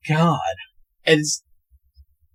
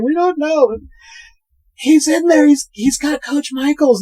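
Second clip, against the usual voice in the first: put-on very high, frantic girl voice; squeaky hammed-up alarm; mock panic; 13 semitones higher than usual. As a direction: imitate girls blurting out bad news. Acts panicked girls running away.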